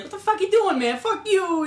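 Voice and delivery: high-pitched, badly faked New Yorker outburst; terrible New York accent. Insulted New Yorker lashing out in an experiment, bad New York accent. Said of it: "it's a terrible New York accent".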